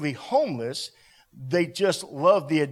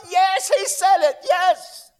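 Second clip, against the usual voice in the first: high, full-force preaching shout; defiant affirmation; 12 semitones higher, 8 dB louder, slower than usual. Real, not acted. Preacher answering the voice of the enemy, shouting defiantly. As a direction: shout down the doubt triumphantly.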